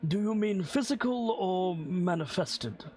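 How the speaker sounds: lightly voiced stiff butler; a hint of a proper old servant; formal butler. Butler Rayburn answers.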